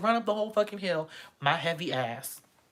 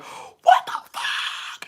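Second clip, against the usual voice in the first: breathy stunned what the fuck; shocked outburst; 5 dB louder, much slower than usual. Retold or imitated. Friends reacting to a surprise kiss, shocked. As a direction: gasp it out in shock.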